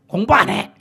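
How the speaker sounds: "공부 안 해!" shouted very loud, defiant; defiant child shout. Retold or imitated. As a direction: yell it like a sulking kid.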